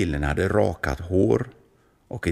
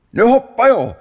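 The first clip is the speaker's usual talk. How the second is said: very high and loud, ready to leap; thrilled kid about to jump; excited announcement; 10 dB louder than usual. Acted. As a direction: shout it before jumping. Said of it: "sa kulan".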